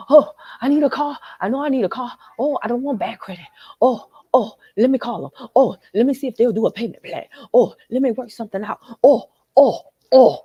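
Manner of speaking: hammed-up panicky debtor, fretting; mock anxious debtor. Mocking viewers who call the collector, anxious. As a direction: play a frantic borrower begging for a plan.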